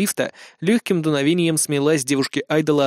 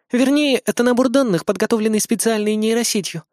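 higher, lightly voiced young woman; Azumi voice; 7 semitones higher than usual. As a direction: play the idol girl, gently apologetic.

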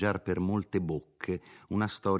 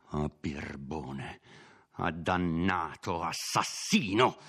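mock furious cry; slower than usual. A narrator's reading. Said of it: "gridava Renzo".

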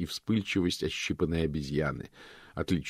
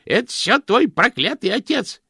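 high, loud shrill outburst; exclaiming; 10 dB louder than usual. Acted. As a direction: cry out at him in outrage. Josephine shrieking.

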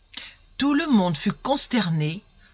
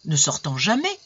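plain reading voice

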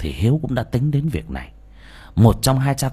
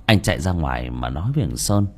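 ordinary delivery

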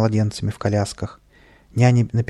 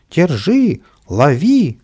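higher, louder chase cries; old folk and nannies shouting after the boys; 7 semitones higher, 6 dB louder, much slower than usual. A narrator's reading. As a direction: yell to catch them. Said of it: "кричали старички и няни".

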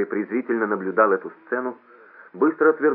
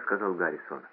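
ordinary delivery

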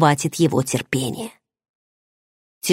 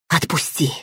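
hoarsely croaked, quiet and strained; hoarse plea; 5 dB quieter than usual. A narrator's reading. Heroine croaking to Varan to let her go, hoarse. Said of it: "прохрипела я".

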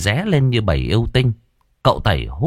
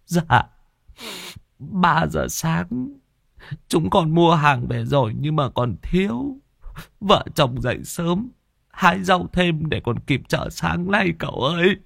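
high, softer whimpering account; tearful; 8 semitones higher, 3 dB quieter than usual. Acted. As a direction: answer meekly, pitched up and near sobbing. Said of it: "Người kia nước mắt lưng tròng". (giọng mếu máo, rưng rưng)

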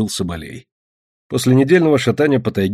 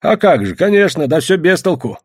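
high, loud peasant reply; raised, higher voice of a luckless trapper; villager's character voice; 7 semitones higher, 4 dB louder than usual. A narrator's reading. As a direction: protest in a pitched-up voice. Fyodor answering the hunter's questions.

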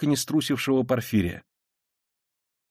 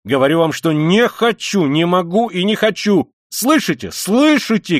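loud, higher, building shout; rising angry cry, louder each time; 7 semitones higher, 7 dB louder than usual. Acted. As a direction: pound the table and yell. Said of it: "крикнул он".

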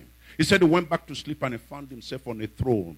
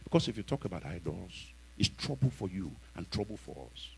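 much softer, hushed cautioning voice; fearful warning; 6 semitones lower, 15 dB quieter than usual. Retold or imitated. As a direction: warn him quietly out of fear. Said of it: "the preacher called him and said".

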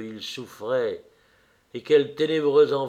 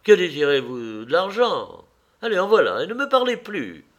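loud, high, impatient outburst; angry cry; 5 semitones higher, 6 dB louder than usual. A narrator's reading. Man paying off the pestering woman, crying out angrily. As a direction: throw the words at a pest to be rid of them. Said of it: "cria Dorian, frappant du pied".

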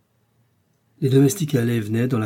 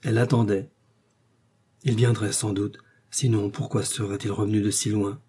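ordinary delivery, nothing marked

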